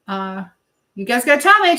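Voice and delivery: usual speaking voice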